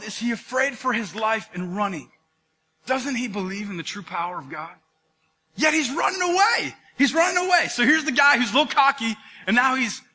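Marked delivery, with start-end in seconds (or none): 5.6-7.7 emphatic exclaim. Real, not acted. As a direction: hammer home the irony. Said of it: "preacher stressing the point".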